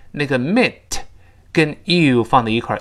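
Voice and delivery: ordinary talk, nothing marked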